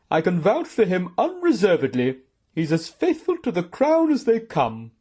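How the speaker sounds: loud, high, emphatic character voice; indignant aunt. Acted. Aunt vouches for her nephew's loyalty, indignant.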